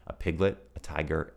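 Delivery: ordinary delivery, nothing marked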